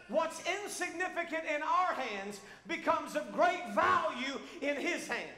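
loud, high climax of the sermon; fiery preaching. Acted. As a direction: drive it home to the congregation. Preaching full force.

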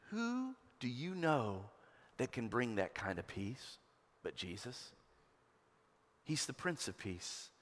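quiet, intimate pulpit moment; hushed preaching. Acted. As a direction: lean in and bring it down to a hush. Hushed reflection after hymn.

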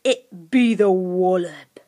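low, slow, forceful creature growl; menacing creature, drawn out; dark creature Grunt. A narrator's reading. Creature warning Lucy of the Wallab, darkly. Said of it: "said Grunt darkly".

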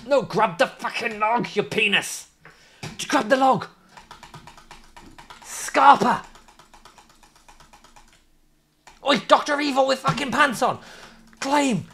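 high, urgent shouted commands; frantic yelling. Real, not acted. Gamer urging his character up the log, high frantic calls. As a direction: bark orders at the screen.

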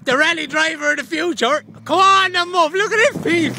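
shouting high and loud over the engine; excited cheering. Real, not acted. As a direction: cheer the driver on at full voice. Cheering rally car from roadside.

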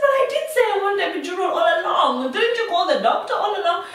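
high, loud, fast retold complaint; indignant protest. Patient asking for her promised epidural, indignant.

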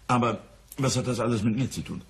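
normal talking voice

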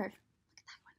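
usual speaking voice

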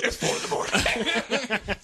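high, slow mock groan through laughter; groggy complaint, laughing. Dunham woken at four AM, mock grumpy laughing. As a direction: play the sleepy friend, laughing. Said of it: "And he goes".

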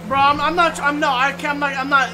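exasperated giving up. Real, not acted. Streamer giving up on an old Italian accent, loudly exasperated. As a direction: burst out, exasperated and stumbling over the words. Gives up on attempting the voice.

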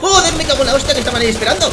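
loud, high, fast outburst of swearing; real startled yell at a sudden ambush; startled shout. Real, not acted. Ambush in the game. (grito de susto)

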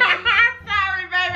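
laughing apology. Real, not acted. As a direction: sing the apology through laughter.

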